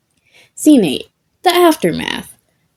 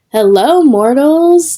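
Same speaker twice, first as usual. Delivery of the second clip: declaring; 6 semitones higher, 4 dB louder, slower than usual. Acted. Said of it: "the lead ghost declared".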